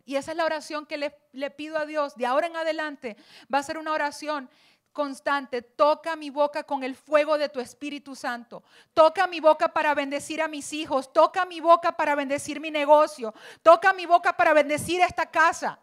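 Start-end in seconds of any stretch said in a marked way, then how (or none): 5.6-15.8 a prayer that builds step by step, ending much louder; fervent prayer. Acted. Pastor praying aloud for the congregation, building fervour. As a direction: pray it out, rising with each plea.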